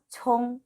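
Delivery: "총" held on an even tone; pronunciation demo. Shown as a demonstration. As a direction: model "총" as one level, drawn-out syllable. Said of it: "총 이렇게 읽으시면 되고요". ("총"을 평평하게 끄는 발음 시범)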